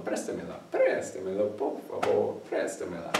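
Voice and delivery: hushed, whispered request; whispering. Shown as a demonstration. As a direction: breathe it almost silently. Quiet repeated polite request.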